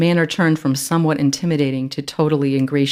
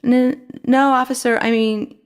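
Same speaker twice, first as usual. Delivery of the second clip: stuttering; 9 semitones higher than usual. A narrator's reading. Girl flustered answering the policeman, nervous stammer. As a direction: trip over the words in nerves. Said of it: "Eliza stuttered".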